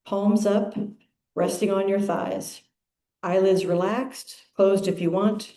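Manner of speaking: unhurried, measured phrases with long pauses; calm, spacious meditation reading; guided meditation. Acted. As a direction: lead the congregation into stillness, phrase by phrase.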